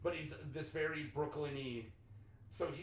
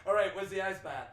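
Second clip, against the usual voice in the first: loud, higher Boston voice; imitated Boston accent; 4 semitones higher, 8 dB louder than usual. Mimicking the Boston guy's roundabout question, Boston accent. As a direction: ask it like a Bostonian, R's dropped. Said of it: "There was a Boston guy".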